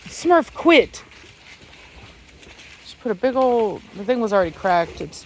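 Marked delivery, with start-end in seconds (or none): 0.0-1.0 high sharp command; scolding the dog. Real, not acted. Owner scolding her dog Smurf, high and loud. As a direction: snap the name then quit, loud.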